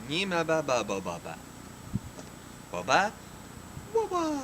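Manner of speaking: loose-jawed high gibberish babble; floppy jaw ya ya drill. Acted. Gibberish babble before translation.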